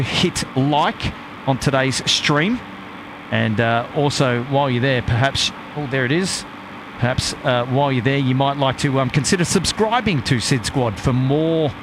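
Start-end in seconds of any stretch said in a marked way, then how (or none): none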